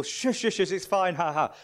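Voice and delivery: high, loud, hurried shushing; mock reassurance. Dad laughing off his hurt kids' crying, mock reassurance. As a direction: brush off tears with a fake ha-ha. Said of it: "trying to sort of say to people".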